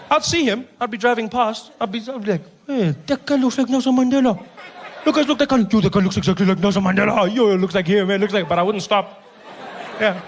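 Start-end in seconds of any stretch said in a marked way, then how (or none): 2.7-8.5 excited driver. Retold or imitated. Excited driver spotting Mandela.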